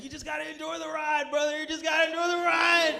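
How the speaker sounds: high, insistent voice building to a shout; hammed-up tripper urging his brother on; fervent stoner buddy. Shirtless buddy preaches to his brother, fervent shout.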